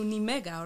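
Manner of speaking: Nimega as a Spanish-style guess, "nee-MEH-ga"; Nimega, a newcomer's misreading of the Dutch name; mistaken pronunciation. Retold or imitated. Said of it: "or something like that".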